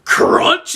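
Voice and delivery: loud, noisy crunch effect; imitated car crunch. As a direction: crunch it like metal hitting. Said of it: "front of his car is like".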